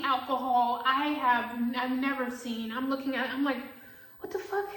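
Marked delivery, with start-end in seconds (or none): 4.2-4.7 a sudden, much higher "what the fuck"; shocked outburst. Retold or imitated. Bartender seeing unfamiliar cheap liquor, high shocked outburst. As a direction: jolt up in pitch at the bottles.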